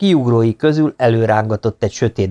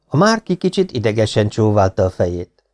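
plain reading voice

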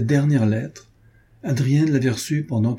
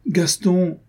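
a wounded protest at his name; pained cry; 8 semitones higher than usual. A narrator's reading. Adrienne crying out to Gaston, hurt and higher.

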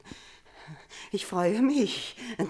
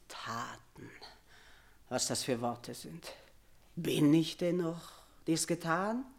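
brooding murmur; 5 semitones lower, 8 dB quieter than usual. Acted. Mother broods over the word.

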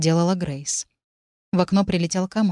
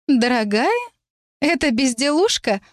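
higher scoffing sneer; 6 semitones higher than usual. A narrator's reading. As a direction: scoff at the word. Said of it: "усмехнулась та".

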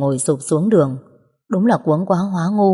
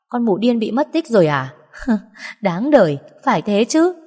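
mocking, higher-pitched gloating with a laugh; cười ha hả; 5 semitones higher than usual. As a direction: gloat at the other's misfortune, laughing. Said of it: "nó cười ha hả qua điện thoại". (giọng chế giễu hả hê, cao giọng)

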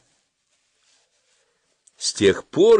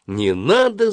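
high-pitched, mild yell; yelled protest; 10 semitones higher, slower than usual. A narrator's reading. Boy to the dentist working in his mouth, mildly yelled. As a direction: cry out in protest, pitched well up. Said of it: "заорал я".

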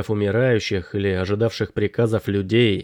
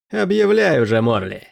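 triumphant smirk; 3 semitones higher than usual. A narrator's reading. Pirate sure of his election victory, triumphant smirk. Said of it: "сказал Смити с победной ухмылкой".

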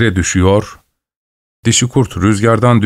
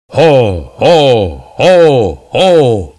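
shouting ho; 2 semitones higher, much slower than usual. A narrator's reading. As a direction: bellow each ho like a train whistle. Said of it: "diye bağırıyordu".

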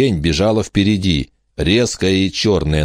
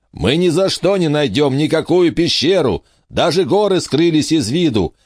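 higher raised voice over a gale; shouting over wind; 10 semitones higher than usual. A narrator's reading. Gabriel shouts back in storm.

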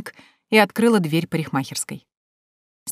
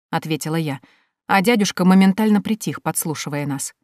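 plain reading voice

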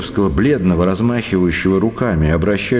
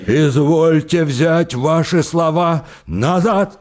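higher, slow, commanding; mock-grand slow order; slow demanding shout; 6 semitones higher, slower than usual. A narrator's reading. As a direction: demand it with heavy dignity. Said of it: "крикнул Самойленко".

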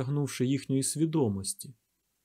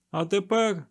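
higher, commanding voice; firm raised-pitch command; peremptory order; 7 semitones higher, 3 dB louder than usual. A narrator's reading. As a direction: announce it as an order, no argument allowed. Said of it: "безапеляційно звелів він".

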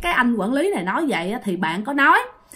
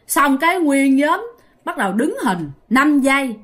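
usual speaking voice

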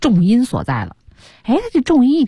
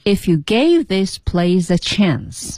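if you gave this place a chance 放慢清楚地读; slow careful demo; much slower than usual. Shown as a demonstration. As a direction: spell out the line slowly for learners. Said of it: "照常读". (慢速示范朗读)

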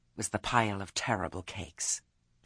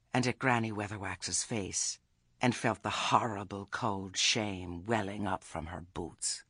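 ordinary delivery

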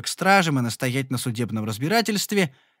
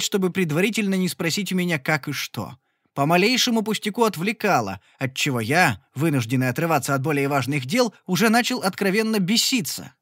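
usual speaking voice

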